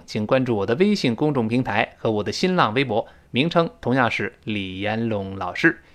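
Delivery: ordinary talk, nothing marked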